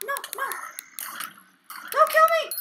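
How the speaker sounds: high-pitched panicked squeal; voice gone high. Real, not acted. Player begging a zombie for life, high panicked voice. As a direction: squeak it out in real fright, ending loud. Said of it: "My voice got all high there".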